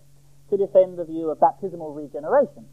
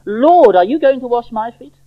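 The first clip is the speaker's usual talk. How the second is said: higher, louder shocked question; raised-voice shock at the very idea; shocked protest; 7 semitones higher, 10 dB louder than usual. Acted. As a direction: protest in disbelief as the reader voices a disciple. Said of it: "The disciple is shocked".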